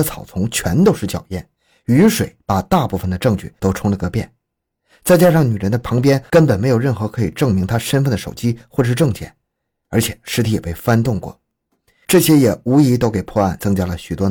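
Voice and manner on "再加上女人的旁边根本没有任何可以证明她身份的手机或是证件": ordinary delivery, nothing marked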